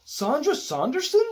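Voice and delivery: high amazed question; starstruck surprise. A narrator's reading. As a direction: gasp out her name. Starstruck neighbor recognizes star.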